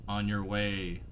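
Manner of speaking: normal talking voice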